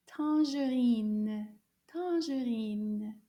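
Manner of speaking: "Tangerine" said slowly, syllable by syllable; slow clear model. Shown as a demonstration. Teacher models the fruit name for learners, slowly. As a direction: stretch out "Tangerine" for the class. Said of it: "how to say this fruit".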